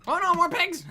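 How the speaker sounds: high, loud alarmed cry; excited yelp. Real, not acted. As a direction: yelp in sudden panic. Gaming, pigs attack.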